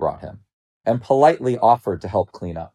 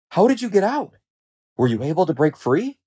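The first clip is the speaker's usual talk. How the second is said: high, eager questioning; curious lions; 8 semitones higher than usual. A narrator's reading. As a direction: ask keenly with lifted pitch. Other lions question him curiously.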